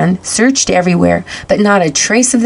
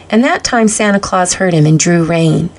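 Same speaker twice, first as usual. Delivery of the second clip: usual speaking voice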